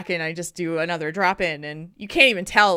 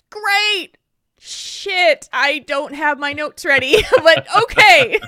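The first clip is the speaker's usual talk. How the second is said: flustered surprise; 7 semitones higher, 8 dB louder, slower than usual. Retold or imitated. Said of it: "And I was like".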